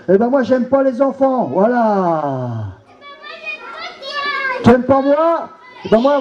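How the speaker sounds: a nasty puppet villain crowing; gloating villain. Acted.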